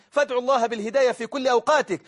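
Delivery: usual speaking voice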